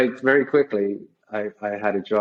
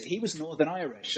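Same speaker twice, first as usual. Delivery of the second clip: ordinary talk, nothing marked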